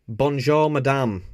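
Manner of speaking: put-on light French accent greeting; a hint of French charm; mock French. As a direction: say it like a hammed-up Frenchman.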